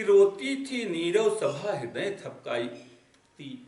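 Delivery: very slow, savoring each word of रोती थी नीरव सभा; slow recitation. Shown as a demonstration. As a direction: dwell on every word of the line. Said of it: "आगे करें कि".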